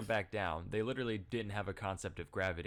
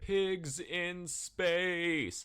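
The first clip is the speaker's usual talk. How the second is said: announcer voice; 8 semitones higher, 5 dB louder, much slower than usual. Acted. Dramatic Muppet announcer parody.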